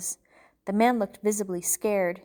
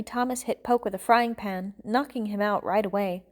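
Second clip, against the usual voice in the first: ordinary delivery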